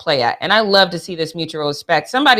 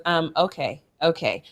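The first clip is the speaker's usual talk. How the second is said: soft, hushed warning; a hint of a hushed HR warning; quiet warning; 4 semitones lower, 9 dB quieter than usual. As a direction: nudge a colleague under your breath. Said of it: "She goes".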